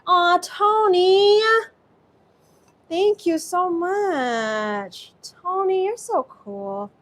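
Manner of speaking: high, drawn-out squeal of gratitude; overjoyed, long-held high vowels; gushing thanks. Real, not acted. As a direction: stretch the words out high, touched and delighted. Thanks a viewer for support.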